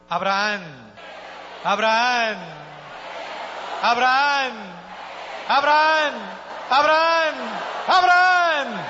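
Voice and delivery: calling out, climbing to a high shout; building call. Acted. Calls Abraham as God, building. (llamado que crece hasta el grito)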